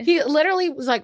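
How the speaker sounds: ordinary talk, nothing marked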